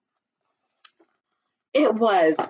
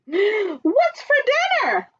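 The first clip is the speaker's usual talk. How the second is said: duck voice; 13 semitones higher than usual. Acted. Duck asks.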